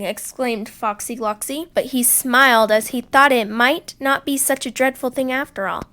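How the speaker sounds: usual speaking voice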